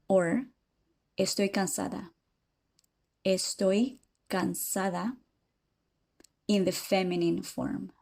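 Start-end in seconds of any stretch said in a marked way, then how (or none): none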